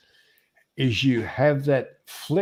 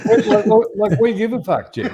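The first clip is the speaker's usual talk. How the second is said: laughing through the words, a little louder; 6 semitones higher, 5 dB louder than usual. Real, not acted. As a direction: talk through real laughter. Laughing while mocking Kiwis.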